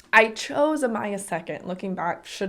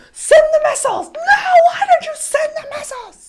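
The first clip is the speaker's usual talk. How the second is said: very high, loud laughing shriek; tantrum dictator; 9 dB louder than usual. Pretend. Joking daughter as dictator screaming orders. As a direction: scream orders like a toddler tyrant.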